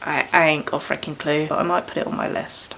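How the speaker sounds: ordinary talk, nothing marked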